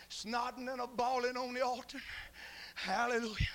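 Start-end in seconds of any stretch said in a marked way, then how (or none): none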